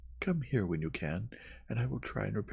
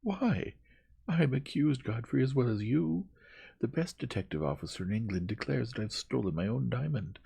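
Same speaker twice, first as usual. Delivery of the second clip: high, loud, incredulous protest; indignant outburst; 8 semitones higher, 5 dB louder than usual. A narrator's reading. Young woman protesting she too is accused, indignant. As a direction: throw the accusation back heatedly.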